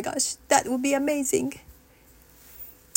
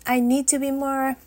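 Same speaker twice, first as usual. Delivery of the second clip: ordinary delivery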